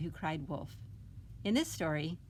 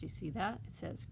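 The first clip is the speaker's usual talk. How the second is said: ordinary delivery, nothing marked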